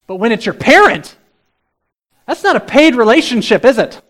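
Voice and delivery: loud, high, driving preaching; insistent pulpit crescendo, pitched high; full-force preaching. Real, not acted. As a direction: preach it at the top of your voice.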